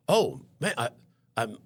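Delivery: stammered, startled quote; taken aback. Retold or imitated. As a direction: retell being lost for words, voice jumping up. Taken aback by patient's crying.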